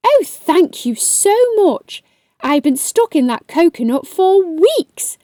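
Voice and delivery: grateful octopus. Acted. Freed octopus thanks them.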